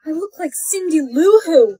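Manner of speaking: usual speaking voice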